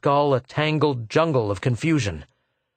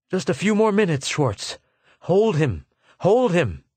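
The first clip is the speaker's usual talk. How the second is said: higher, urgent gasped plea; gasped urging; 6 semitones higher than usual. A narrator's reading. Arviden urging Schwartz to hold him, gasped and urgent. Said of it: "He gasped".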